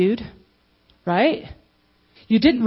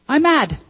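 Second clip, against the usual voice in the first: higher, louder I'M MAD; owning it; 6 semitones higher, 4 dB louder than usual. Shown as a demonstration. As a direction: claim the feeling as your own, firm and clear. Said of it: "So if you get mad".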